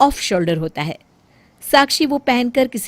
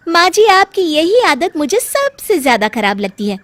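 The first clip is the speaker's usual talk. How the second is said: higher, louder snappy daughter-in-law; irritated young bahu; 6 semitones higher, 7 dB louder than usual. Acted. Bahu answers her mother-in-law, loud and irritated. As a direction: snap back at the nagging mother-in-law.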